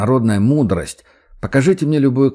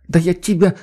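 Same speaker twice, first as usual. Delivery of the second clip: mock threat; 8 semitones higher than usual. A narrator's reading. Маша pretends to strangle.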